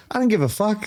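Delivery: high-pitched mimicked Irish woman; indignant Irish woman. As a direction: throw it out high, dismissively. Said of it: "this one Irish woman who was like".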